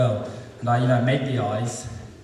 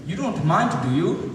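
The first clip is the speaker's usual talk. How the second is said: higher-pitched mock Bangladeshi accent; put-on Bangladeshi voice, pitched up; 5 semitones higher, slower than usual. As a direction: imitate a Bangladeshi man asking politely. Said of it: "before I do the accent".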